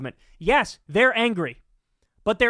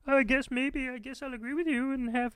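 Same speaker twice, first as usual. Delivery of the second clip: mock spineless politician; 5 semitones higher, 6 dB quieter than usual. Mocking the politician's wishy-washy answer.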